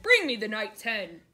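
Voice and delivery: loud booming order; giant's command. A narrator's reading. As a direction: bark it like a greedy giant.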